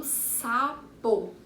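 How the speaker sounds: "sapo" slowly drawn out; measured two-beat "sapo"; stretched-out syllables. Shown as a demonstration. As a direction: linger on both syllables of "sapo". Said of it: "Duas sílabas".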